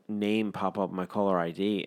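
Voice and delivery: ordinary delivery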